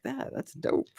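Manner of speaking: unvoiced, impressed whisper; mock impressed. To Nate, faking being impressed by the watch: hushed. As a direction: breathe it like you're impressed. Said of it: "And I'll be like".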